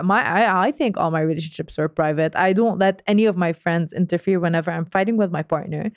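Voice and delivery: usual speaking voice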